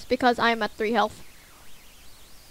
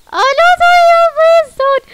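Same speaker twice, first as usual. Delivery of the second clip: high, loud pleading wail; desperate cry; 12 dB louder than usual. Real, not acted. Player begging Toriel not to leave, a high loud wail. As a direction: cry out for her to stop.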